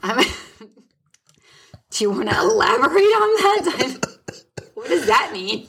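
breathy laughing question; laughing whisper. Real, not acted. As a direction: ask it through a giggle. Laughing reply to being called rare.

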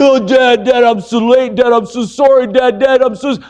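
put-on high, hurried pleading teenager; apologetic son. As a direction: tumble out frantic apologies to Dad. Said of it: "He said".

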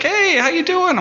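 very high, upbeat imitated hello; hearty greeting. Bar owner comes out back and greets him, high and hearty. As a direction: sing out a big friendly greeting.

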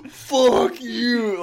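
imitating a hustler's outburst; angry outburst. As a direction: flip from nice to furious. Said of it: "whenever that person is like".

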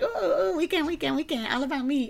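high, mocking sing-song of herself; mock self-centered gush. Host mocking how she brags about her weekend, high sing-song. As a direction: lampoon her own bragging, cooing high. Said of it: "and I'm like".

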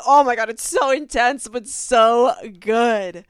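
loud, drawn-out swoon; gushing loudly. Real, not acted. Fan gushing about the bee scene to viewers, loud swoon. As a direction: let it burst out of you.

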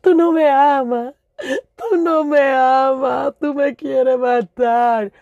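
put-on high tearful kid pleading in Spanish and English. Little girl pleading with her dad, high tearful voice. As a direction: beg like a betrayed child. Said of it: "I pleaded with my dad".

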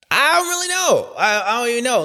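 high exasperated cry. Real, not acted. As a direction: throw up your hands, baffled. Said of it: "I don't know".

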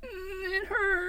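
whimpering. Acted. Injured boy whimpers.

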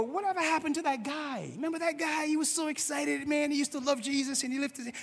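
lightly voiced nosy church member; gossiping churchgoer. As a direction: lean in like sharing church gossip. Churchgoer gossiping about a dropout.

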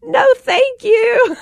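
high, slow laugh-cry refusal; mortified thanks. Retold or imitated. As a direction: squeak it out mortified. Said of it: "And I was like".

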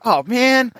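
mock dismay at a prank; exasperated groan. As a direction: whine it like you've been had. Reacting to being timed.

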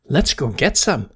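loud eager chick; excited. A narrator's reading. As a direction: urge them on excitedly. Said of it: "said Jerry excitedly".

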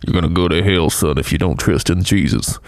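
low, put-on preacher voice; mock hellfire preacher. Preacher parodying a blunt warning of hell, mock stern. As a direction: drop low and warn like a hammy evangelist. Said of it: "it feels weird at times to be like".

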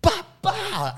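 high sharp bah bah punch noises; punch sounds. Retold or imitated. Arborist landing jabs on the guy, punch noises. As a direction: mimic two quick punches. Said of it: "she just went".

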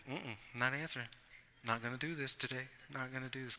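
very soft, low mumbled refusal; sleepy refusal. Retold or imitated. Pretending to sleep through Casey's calls, a soft low grumble. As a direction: grumble it under your breath, half asleep. Ignoring Casey half asleep.

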